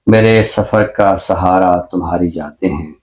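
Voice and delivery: ghazal recitation. Acted. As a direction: savour each line of the couplet. Recites his ghazal on air. (غزل کا ٹھہرا ہوا ترنم سے پاک پڑھنا)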